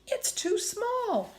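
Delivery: high, slow frightened pleading; afraid. Acted. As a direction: plead in fear. Fearful warning at branch.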